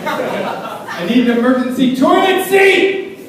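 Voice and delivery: loud, slow, high comic yell; mock desperate demand. Panicking passenger to flight crew, loud desperate demand.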